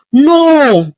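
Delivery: high, loud cry of no; anguished yell. Acted. Tubia cries out as Goldie collapses, high anguished yell. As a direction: shout out in helpless horror. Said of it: "Tubia yelled".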